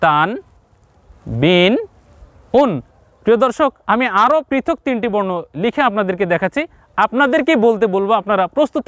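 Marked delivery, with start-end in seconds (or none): 0.0-2.7 "তান, বিন, উন" one by one, each closed on n; pronunciation demo. Shown as a demonstration. Teacher recaps three letters with tanween, pronunciation demo. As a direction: model "তান, বিন, উন" as separate, held syllables. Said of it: "আমরা পেয়ে গেলাম কি?".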